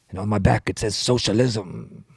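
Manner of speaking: put-on senator's accent, dragging out SOCIALISM; Bernie Sanders. Politician showing his tattoo, exaggerated impression. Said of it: "why do you say it like that".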